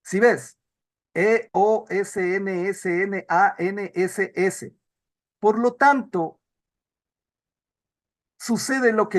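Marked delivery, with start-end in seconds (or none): 1.1-4.8 slow spelling out. Shown as a demonstration. Teacher reads endings letter by letter.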